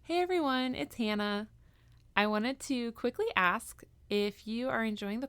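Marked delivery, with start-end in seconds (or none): none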